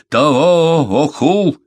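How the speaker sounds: loud, high, drawn-out cry; shouting. A narrator's reading. Беккер calls Амурея to his plan, shouting. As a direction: yell it slowly, rising at the end. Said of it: "крикнул он".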